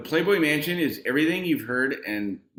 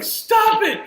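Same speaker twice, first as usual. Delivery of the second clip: high, loud shout through laughter; laughing shout; 8 dB louder than usual. Retold or imitated. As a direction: yell it while you can't breathe from laughing. Laughing friend yelling at drunk.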